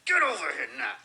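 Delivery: mock-angry, forceful order to a child; intensified mad mother. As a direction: imitate an old-school mother who means it now. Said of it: "She intensifies her expression".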